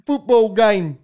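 slow, high mock British rocker. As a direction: drag it out like a befuddled rock star. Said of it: "[name]. The Prince of Darkness".

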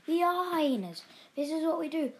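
high-pitched, exuberant declaration; gleeful hyena father; proud proclamation. A narrator's reading. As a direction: declare it with rising pride.

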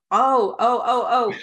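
high, loud excited exclamations; loud high delighted oh, oh. Real, not acted. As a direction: burst out with recognition. Reacting to Bronx story.

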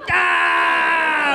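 high, sustained mock war cry; imitated battle yell. Pursuer pulling up beside the teens, imitated yell. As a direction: imitate a raging attacker's holler. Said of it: "He goes".

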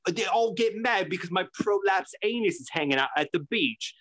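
high mock British grumble; British accent. Imitating the man on the tattoo show, high British accent. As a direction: lift the pitch into a put-on British accent. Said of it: "doing a British accent".

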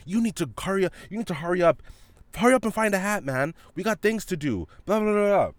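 high-pitched mocking mom voice, rushing him; nagging mom. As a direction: mimic an impatient mother hurrying her son. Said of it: "all while telling him things like".

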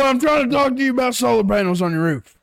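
high, loud hammed-up yell; over-the-top shouted sales pitch; hollering. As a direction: play a furious salesman bellowing at a homeowner. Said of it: "Hollering at 'em".

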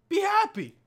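high bright burst; excited cheer. Real, not acted. Cheering viewers about the giveaway, high excited call. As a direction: lift them up with it.

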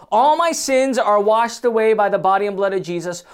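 ordinary talk, nothing marked

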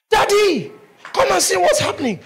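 loud, high excited call; calling out to daddy. Retold or imitated. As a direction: shout for him to come see, almost laughing. Girl calling her father.

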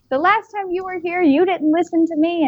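imitating her little daughter. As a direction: pitch it up like a scolding small child.

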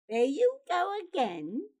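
high, loud accusation; indignant cry. A narrator's reading. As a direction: cry out in exasperation. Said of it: "Pyotr cried".

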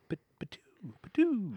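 spitting sound made with the mouth, "patoo"; mimed spit noise. Shown as a demonstration. Host offering a substitute spit sound, mock spit. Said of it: "How about this?".

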